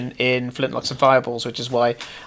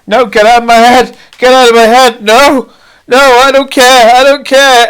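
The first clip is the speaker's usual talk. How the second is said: high, loud panicked screams; crewmates screaming in panic; 10 semitones higher, 12 dB louder than usual. Acted. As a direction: scream at the voice in your head. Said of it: "They go".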